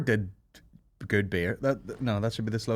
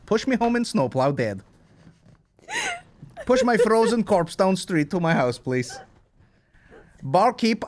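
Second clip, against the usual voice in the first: mock Russian; 4 semitones higher than usual. Mock Russian persona riff.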